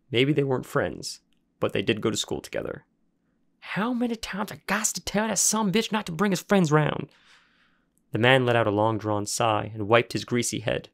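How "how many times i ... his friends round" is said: high, put-on backwoods grumble; fed-up rural worker complaining; exasperated grump. Junkyard man fuming about his son, exasperated.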